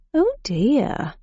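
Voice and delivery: sorry to disappoint them; apologetic. A narrator's reading. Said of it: "in an apologetic tone".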